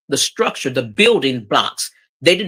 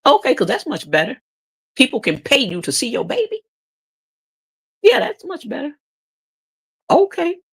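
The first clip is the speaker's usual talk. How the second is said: high sarcastic okay; 11 semitones higher than usual. Real, not acted. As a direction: drip with sarcasm. Sarcastic reply to mama.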